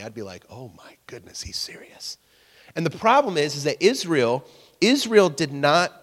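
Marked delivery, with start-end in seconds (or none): 0.5-2.2 hushed, whispery alarm; mock terrified. Preacher imagining hearing God's threat, acting terrified in a hush. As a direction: act awed dread, dropping to a whisper. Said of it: "that would terrify me".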